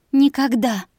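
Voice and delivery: loud defiant cry; defiant shout. Acted. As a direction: fling it back in defiance. Defiant reply to captor.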